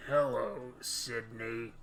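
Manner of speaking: a hint of Ghostface; Ghostface impression.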